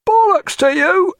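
loud, high taunt; jeering shout. A narrator's reading. Bow-port sailor to rival ship, loud high jeer. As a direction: jeer across to the other ship.

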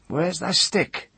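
high, slow crone's demand; demanding old woman. A narrator's reading. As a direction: ask it slowly in a high old voice.

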